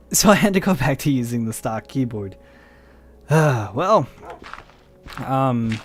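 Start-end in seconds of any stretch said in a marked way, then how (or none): none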